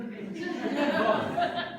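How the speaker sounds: loud, high laugh; high burst of laughter. Real, not acted. Laughs at own joke.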